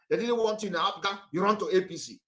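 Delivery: ordinary talk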